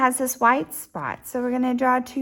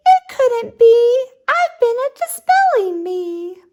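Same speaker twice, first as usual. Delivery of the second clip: high squeaky little squirrel; 13 semitones higher, 4 dB louder than usual. Acted. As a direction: pitch it way up like a tiny squirrel. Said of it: "Squirrel said".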